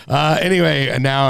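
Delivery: ordinary talk, nothing marked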